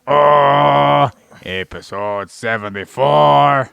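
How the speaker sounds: loud, drawn-out show opener; belted episode announcement. Acted. Host announces the episode to listeners, loud and drawn-out. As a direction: stretch it out like a ring announcer.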